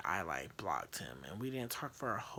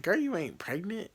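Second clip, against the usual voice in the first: mock incredulous; 7 semitones higher, 7 dB louder than usual. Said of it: "She's like".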